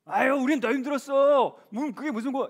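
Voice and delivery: high dismissive mock of a church elder; wrong comfort. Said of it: "이러시면 안 돼요". (높은 목소리로 흉내 낸 무시하는 위로)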